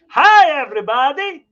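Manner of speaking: high, loud cartoon doctor greeting; Doctor Nick impression. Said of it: "Hi, Doctor Nick".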